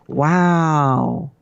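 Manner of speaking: drawn-out wow in an actor impression; celebrity catchphrase impression; Owen Wilson. Shown as a demonstration. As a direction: stretch the wow like an awed movie star. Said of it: "do my best Owen Wilson impression".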